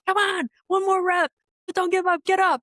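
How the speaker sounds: high, loud coach shout; gym yelling. Retold or imitated. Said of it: "it's a lot of yelling".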